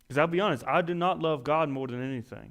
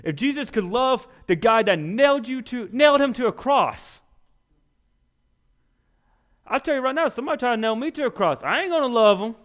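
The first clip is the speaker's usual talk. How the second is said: fiery preaching; 9 semitones higher, 6 dB louder than usual. Acted. Preaching at full force.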